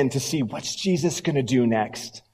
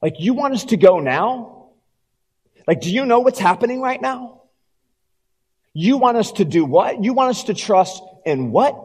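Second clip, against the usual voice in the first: incredulous objection; 5 semitones higher than usual. Acted. Congregation protesting to Jesus, higher and disbelieving. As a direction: push back at each request in disbelief. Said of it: "And we say".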